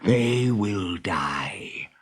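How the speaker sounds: whispered, very slow threat; whispered gloating menace; villainous whisper. Acted. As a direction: hiss it with relish. Hook's gleeful threat.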